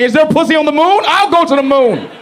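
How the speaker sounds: high, eager lust-crazed guy; hint of a desperate dude; mock horny guy. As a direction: pitch it up like a man ready to fly anywhere. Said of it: "Every man's like".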